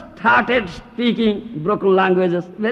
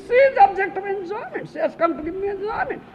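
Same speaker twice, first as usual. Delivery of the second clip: mock gruhamedhi voice; 12 semitones higher, faster than usual. Voices attached man's view.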